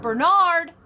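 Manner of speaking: loud, high warning shout; coach's full-voiced reprimand. A narrator's reading. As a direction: shout the name to stop them. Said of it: "Coach West shouts".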